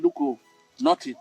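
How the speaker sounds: ordinary talk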